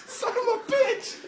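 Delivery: slow, high, loud laugh-through curse; laughing exclamation. Real, not acted. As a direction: drag it out while laughing. Laughing at a friend.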